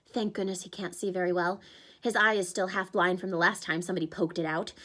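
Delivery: ordinary delivery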